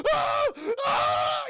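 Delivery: two very high, loud screams; hammed-up shrieks of agony; mock screaming. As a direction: scream as the old man being eaten. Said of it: "He's screaming and shit".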